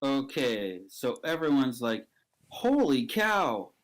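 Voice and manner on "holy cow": amazed exclamation. Acted. Storyteller voices onlookers seeing a sweatshirt repel bullets, amazed.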